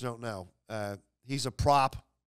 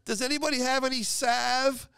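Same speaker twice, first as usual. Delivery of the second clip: high, pained squeaky voice; burned friend; 11 semitones higher, 3 dB louder, much slower than usual. Retold or imitated. Burned friend begging coworkers for salve, high pained squeak. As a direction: squeal for ointment through the sting. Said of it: "And he was saying".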